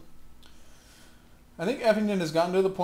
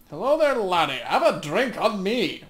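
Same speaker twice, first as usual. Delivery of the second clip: put-on loud, high, boisterous old woman, slowing on the drink offer; hearty old camp follower; 4 semitones higher, 8 dB louder than usual. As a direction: bellow a friendly welcome across the tavern. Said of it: "why she talks like that".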